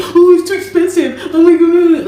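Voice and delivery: high, whiny imitation of a complaining man; mock whining complaint. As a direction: whine it like a spoiled complainer. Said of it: "He was always complaining".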